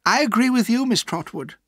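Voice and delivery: high, insincere politeness; false smile. A narrator's reading. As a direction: agree with a fake smile in the voice. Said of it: "smiling falsely".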